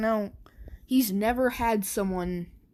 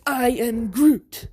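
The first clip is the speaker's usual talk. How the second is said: Groot voice; 2 semitones higher, 6 dB louder, slower than usual. Acted. Deku answers back louder.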